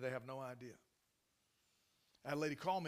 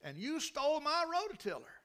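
angry accusation; 10 semitones higher, 9 dB louder than usual. Retold or imitated. Wronged landlady accuses the pastor, loud and outraged. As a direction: hammer each accusation home.

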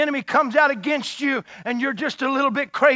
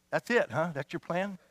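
doubtful armor bearer; 7 semitones lower, 10 dB quieter than usual. Retold or imitated.

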